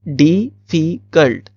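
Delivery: Difficult drawn out slowly, each syllable clear; teacher repeating difficult slowly for the class. Shown as a demonstration. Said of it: "तो इसमें भी तीन sounds हैं".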